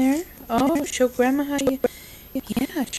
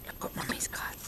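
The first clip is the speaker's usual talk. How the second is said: whisper; 16 dB quieter than usual. Real, not acted. Mom whispers to baby.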